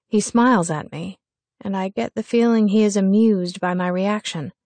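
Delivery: usual speaking voice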